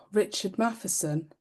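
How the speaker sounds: ordinary talk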